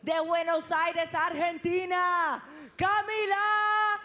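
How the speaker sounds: announcer shout. Real, not acted. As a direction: draw the name out in song. Announcer calls fighter's name.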